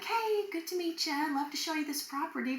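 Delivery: hide the excitement behind a sweet business tone; forced professional. Retold or imitated. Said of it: "gather myself and go in".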